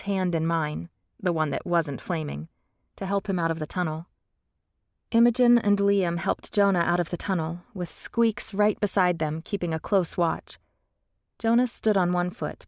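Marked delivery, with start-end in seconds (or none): none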